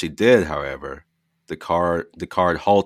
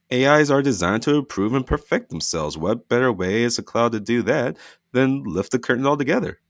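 lightly voiced son; 7 semitones higher, 4 dB louder than usual. Stu voiced by narrator.

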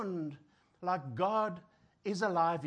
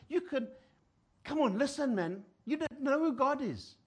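higher, louder lofty lecturing; podium lecture; 4 semitones higher, 5 dB louder than usual. Retold or imitated. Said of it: "standing on a podium saying".

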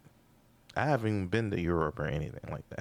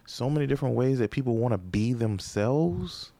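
building rant; 6 semitones higher than usual. Real, not acted.

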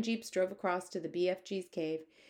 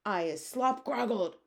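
squawky giant voice; hammed-up squawking giant; giant's squawk; 3 semitones higher, 3 dB louder than usual. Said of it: "squawked the gizzard gulper".

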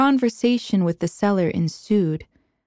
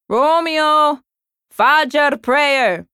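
loud distant call, raised pitch; booming from another room; 7 semitones higher, 6 dB louder, much slower than usual. Acted. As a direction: boom it out from down the hall. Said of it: "Bounty's booming voice from the other room".

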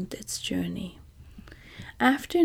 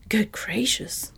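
mild drawn-out astonishment; understated storyteller's gasp of wonder in the words; surprised exclamation. A narrator's reading. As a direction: exclaim it slowly, with a touch of surprise. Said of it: "the Scarecrow exclaimed at hearing this".

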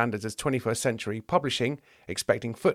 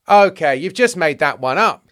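loud, raised accusation; indignant challenge; 5 semitones higher, 9 dB louder than usual. Acted. Teacher of the law challenges James's quote, indignant. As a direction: call out the bluff firmly.

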